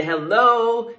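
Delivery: loud, high pastor's call of hello; pastor's greeting call. Shown as a demonstration. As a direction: call it out to the church. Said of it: "You hear the pastor say".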